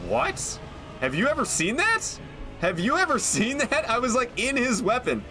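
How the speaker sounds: high, amazed disbelief; high astonished disbelief at a glitch; surprised. Real, not acted.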